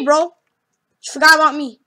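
normal talking voice

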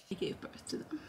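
soft joking whisper. Real, not acted. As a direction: whisper it like a secret.

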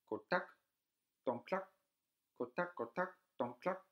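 high, loud clucking hen voice. A narrator's reading. A strange hen telling the sister where the head is, clucking high. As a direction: cackle it out like a magic hen. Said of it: "ein Huhn laut gackern".

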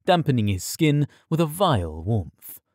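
ordinary delivery, nothing marked

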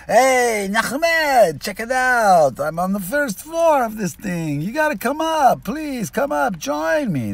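very high, loud shouting down from the tower; yelling up loud. Retold or imitated. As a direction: yell it excitedly across a great height. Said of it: "he's yelling down at him really loud".

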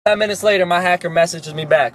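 ordinary talk

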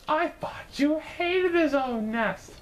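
high and slow in wonder; astonished. A narrator's reading. Mister Bird questions his wife, high and slowly amazed. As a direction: stretch the words out in disbelief. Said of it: "gasped Mister Bird".

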